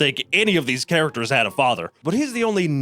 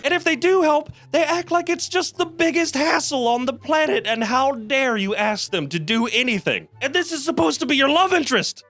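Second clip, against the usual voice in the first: exasperated; 9 semitones higher than usual. Real, not acted. Exasperated rant about love interest.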